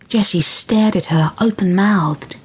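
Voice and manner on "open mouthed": usual speaking voice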